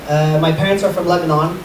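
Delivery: usual speaking voice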